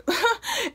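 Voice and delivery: quick high laugh; short laugh. Real, not acted. Laughing about her mom's packed trunk, quick high laugh. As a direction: laugh at the mess.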